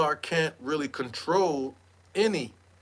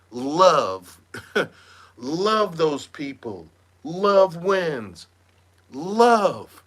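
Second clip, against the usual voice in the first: louder drawn-out mock love; Solar's mantra; 3 dB louder, much slower than usual. Mocking Solar soothing his wife with love. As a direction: croon love like a guru. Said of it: "Solar's reaching in".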